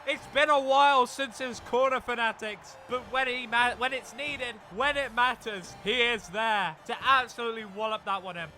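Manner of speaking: high, loud goal call; excited commentary. Real, not acted. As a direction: hype up the winning goal.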